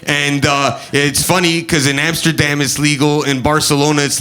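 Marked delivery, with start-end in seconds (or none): none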